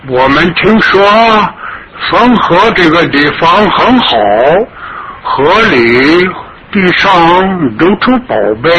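a high, greedy foreigner's voice. Acted. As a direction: gloat over the treasure of the river. Foreigner boasts about the treasures.

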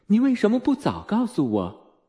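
a touch of motherly reproach, not shouted; mother's exclamation. A narrator's reading. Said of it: "她妈妈喊道". (母亲略带责备地喊道)

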